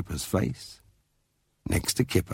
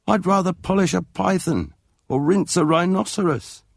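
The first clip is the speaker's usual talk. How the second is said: higher, indignant mother's voice; exasperated Mum; 11 semitones higher, 3 dB louder than usual. A narrator's reading. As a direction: scoff at the idea.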